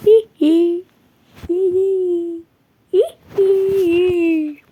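high whimpering hi hi; hammed-up scared crying; mock whimpering. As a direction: fake frightened whimper. Fake crying in fear.

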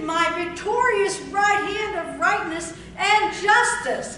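loud, high, slow preacher's cry; fiery pulpit reading; preaching at full force. Acted. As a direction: thunder the promise to the congregation.

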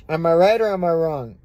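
ordinary talk, nothing marked

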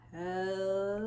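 hugely stretched, low, soft greeting; as slow as possible. Shown as a demonstration. Teacher showing children opposite hellos, as slow as possible. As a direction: draw it out as long as breath allows. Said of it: "as slow as we can".

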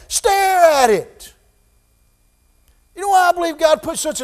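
high, loud, fervent sermon climax; preaching at full force. Acted. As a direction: preach it out, urging them to look.

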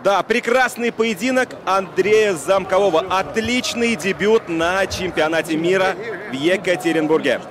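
ordinary delivery

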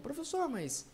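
hesitant high student interjection; questioning student. Acted. Imagined student asks the teacher a question, questioning. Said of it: "imaginar a sua pergunta".

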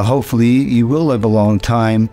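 normal talking voice

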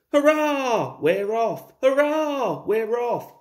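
high, loud cheer; jubilant, falling hurrahs; happy puffing. A narrator's reading. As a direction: cheer as you set off, delighted. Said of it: "puffed Thomas happily".

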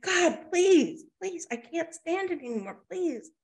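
high-pitched acted plea; desperate begging. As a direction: beg as if at the end of endurance. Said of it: "he's begging".

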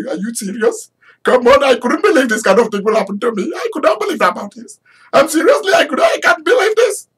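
put-on high, fast Indian-accented disbelief; surprised Indian accent. Mordecai marvels at his good fortune in an Indian accent. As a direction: rattle it off in an Indian accent, amazed. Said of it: "the way Indians talk when they are surprised".

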